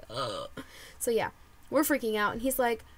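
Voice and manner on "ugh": very low, soft croaked groan; imitated groan. Fan overwhelmed meeting the host's wife, low mock groan. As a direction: let out a low creaky ugh. Retells her overwhelmed reaction.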